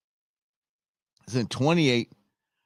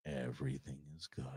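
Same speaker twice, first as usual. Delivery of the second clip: calm commander; 9 semitones lower, 21 dB quieter than usual. Retold or imitated. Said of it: "As long as he's talking like this".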